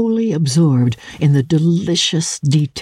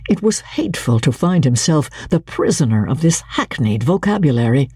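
usual speaking voice

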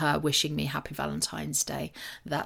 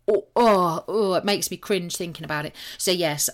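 normal talking voice

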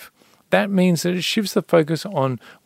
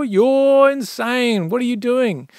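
incredulous neighbours; 8 semitones higher, 4 dB louder, slower than usual. Retold or imitated. Neighbours think farmer mad.

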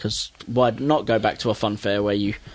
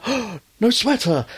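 high, startled cartoon devil cry; the devil suddenly notices he's not wearing it; devil spotting the sweater; 9 semitones higher than usual. Retelling the cartoon, devil noticing the missing sweater, in a high startled voice. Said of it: "He's like".

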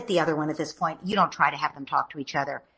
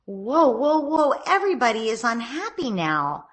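calm parent intervening; 5 semitones higher than usual. Shown as a demonstration. Parent stepping in between squabbling kids, raised calling voice. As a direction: step in brightly to stop the fight. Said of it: "You'd say".

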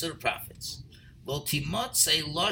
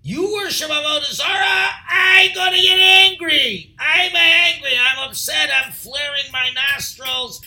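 much louder and higher, furious shouting as God; angry God voice; 8 semitones higher, 10 dB louder than usual. Acted. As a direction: roar it out as an offended God. Voicing Hashem's anger at idolatry.

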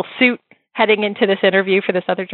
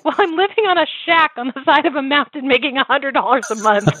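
higher, sheepish confession; imitating her younger self; 7 semitones higher than usual. [name] telling Deborah about the shack, higher wry voice. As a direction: list it with a wry pitched-up voice. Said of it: "And I was like".

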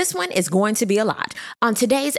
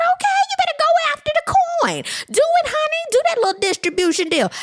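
very high, squealed mock encouragement; hammed-up cheering on. Podcaster cheering a couple's business deal, high playful hype. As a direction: egg them on in a high put-on voice.

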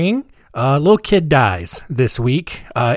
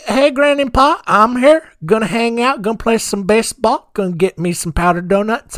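higher-pitched imitation of a six-year-old; mock chirpy kid announcing himself to grandparents; little kid; 6 semitones higher than usual. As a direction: play your childhood self, pitched up. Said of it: "a little six-year-old kid".

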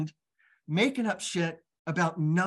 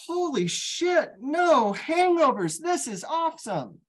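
amazed delight; 13 semitones higher, 3 dB louder, slower than usual. Retold or imitated. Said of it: "And I'm like".

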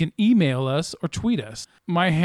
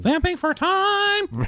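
high mock exclaim; 11 semitones higher, much slower than usual. Mock announcement.

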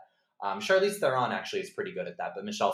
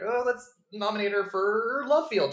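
mock Academy voter; 11 semitones higher, 6 dB louder than usual. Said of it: "They're like".

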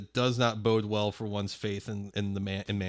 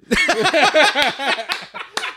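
high shrieking laughter; 8 dB louder than usual. Real, not acted. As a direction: burst out laughing. Bursts out laughing.

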